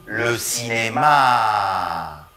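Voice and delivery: slow, last syllable of cinéma held; lengthened final syllable. Shown as a demonstration. Teacher models slow Le cinéma for students. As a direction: linger on the end. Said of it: "Répétons".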